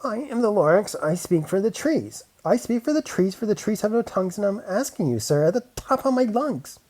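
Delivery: high, loud and bossy; small bossy creature shouting; sharp bossy Lorax. A narrator's reading. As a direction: speak for the trees at the top of your lungs. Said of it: "a voice that was sharpish and bossy".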